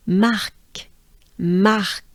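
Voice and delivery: separate, French-accented takes of Mark. Shown as a demonstration. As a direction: Mark twice with a French throat R. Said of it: "with a, with a French accent".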